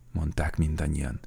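usual speaking voice

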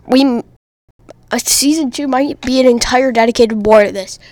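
loud, close to the mic proclamation; loud mock rant right into the microphone; mock lecture. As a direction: declare it like a ranting lecturer. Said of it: "let me give you a little lecture here".

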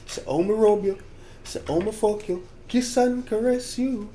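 soft, slow murmured lines; murmured lyric. Retold or imitated. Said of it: "what I say the English words is".

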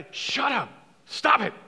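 high, hateful command; stern hushing. Acted. Crowd silencing a man who won't stop, stern. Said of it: "Many were sternly telling him to be quiet".

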